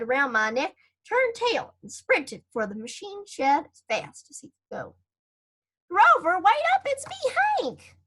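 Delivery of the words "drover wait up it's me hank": high, loud call after a runaway friend. Acted. Hank calls after Drover as he runs away, loud and high. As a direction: shout to stop him running off.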